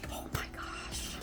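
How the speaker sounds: soft whispered oh my gosh; awed whisper. Real, not acted. Showing viewers the polish, whispering in awe. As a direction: breathe it out in wonder.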